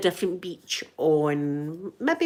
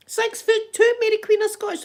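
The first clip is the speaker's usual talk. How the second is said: amazed; 4 dB louder than usual. Real, not acted. Still marvelling at the height.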